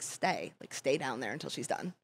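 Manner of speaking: imitated manager, dropped low and soft; low quiet order. Manager telling crouching host to stay, quietly. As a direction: give a hushed command, as to a dog.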